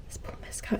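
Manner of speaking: very soft, breath-only whisper; awed whisper. Retold or imitated. Retelling locking eyes with a famous actor, awed whisper. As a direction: whisper it as a stunned realization.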